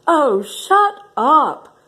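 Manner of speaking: sharp high snap; snapping. Acted. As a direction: bite it off, slow and sharp. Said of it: "snapped a little warthog".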